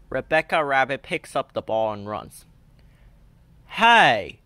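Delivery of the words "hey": loud sharp yell; shouting Danny Dog. A narrator's reading. Danny Dog shouts at Rebecca grabbing the ball, loud. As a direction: call out the foul. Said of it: "shouts Danny Dog".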